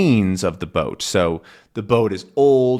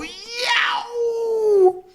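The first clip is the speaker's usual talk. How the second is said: pain shout. Acted. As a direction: shriek it out in pain. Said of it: "which makes him go".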